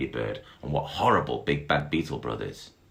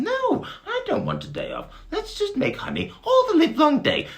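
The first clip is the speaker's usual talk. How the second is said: high, bright bee chirping; sing-song like a tiny bee; very high buzzing bee; 5 dB louder than usual. Acted. Bee agreeing with its friend, very high voice. Bees chatting merrily.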